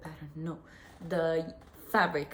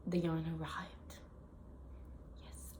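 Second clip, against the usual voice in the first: whisper; 8 dB quieter than usual. Real, not acted. Dramatic reveal of arrived yarn.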